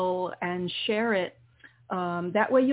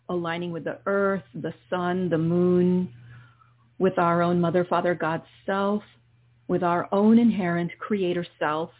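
guided meditation. Acted. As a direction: ease the listener into calm breathing. Leads a guided healing meditation.

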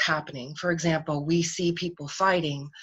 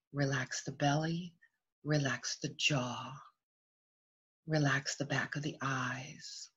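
soft, unhurried meditation voice with long pauses; guided meditation; 6 dB quieter than usual. Real, not acted. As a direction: settle listeners gently into stillness.

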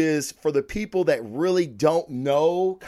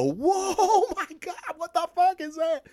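much higher, laughing in shocked delight; amazed laughing gasp. Retold or imitated. Reader turning the page, stunned and laughing with delight. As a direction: squeal it out like the page just blew your mind. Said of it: "when I turn the page and go".

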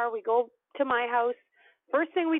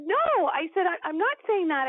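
high-pitched, louder protesting no; emphatic denial; 7 semitones higher, 3 dB louder than usual. Retold or imitated. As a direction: deny it with a high yelp of protest. Denies the dead guy called.

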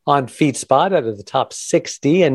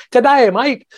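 hammed-up Australian accent, pitched high; a cheesy Aussie greeting, high and bright; tourist-style Australian twang; mock Australian; 11 semitones higher, 3 dB louder than usual. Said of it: "I imitate Australia".

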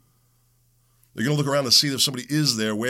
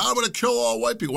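imitating attacker; 12 semitones higher than usual. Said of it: "This guy".

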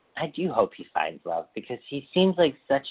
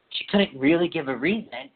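ordinary talk, nothing marked

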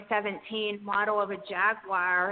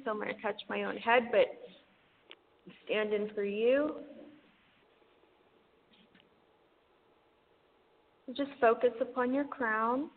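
airy breathy mystical healer. Acted. Guides an energy healing session.

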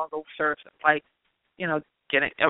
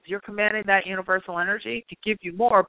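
ordinary talk

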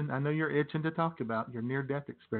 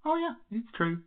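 high squeal; 10 semitones higher than usual. Acted. Catchphrase jumps high.

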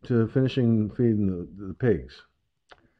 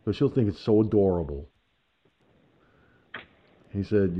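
ordinary talk

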